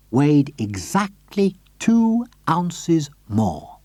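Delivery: slow and deliberate. A narrator's reading. Narrator stressing the plan's detail, slow. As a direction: lay out each word carefully.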